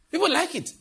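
"People like it" jumping up high in pitch; mock surprise. Shown as a demonstration.

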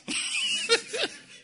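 a high burst of laughter. Real, not acted. Preacher laughing at his own joke, high laugh.

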